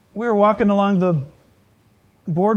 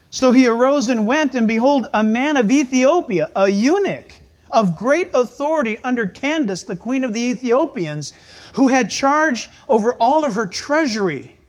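scripture reading; 5 semitones higher, 6 dB louder than usual. Acted. Reading Acts aloud.

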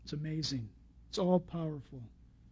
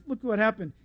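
usual speaking voice

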